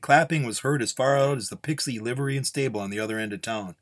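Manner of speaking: plain reading voice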